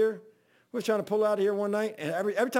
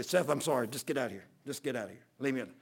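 low, much softer, trailing mutter; subdued grudging apology; 7 semitones lower, 6 dB quieter, faster than usual. Retold or imitated. Preacher apologizes after walking it off, subdued. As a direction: retell a weary apology, dropping low and quiet. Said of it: "trying to calm me down".

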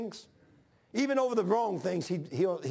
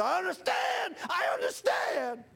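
weeping cry; 12 semitones higher, slower than usual. Retold or imitated. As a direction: cry it out far above your voice, drawn out. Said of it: "He was down here weeping".

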